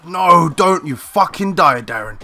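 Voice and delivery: loud, drawn-out yell of panic; real frantic shout, slowed and high; desperate shout. Real, not acted. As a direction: scream at the teammate to stay alive. Begging teammate not to die.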